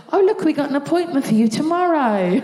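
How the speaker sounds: suddenly helpful receptionist. Retold or imitated. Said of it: "She goes".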